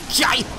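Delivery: high, loud "yai" yelp; battle cry. Real, not acted. Player striking an enemy, battle cry. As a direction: yelp it hard with the blow.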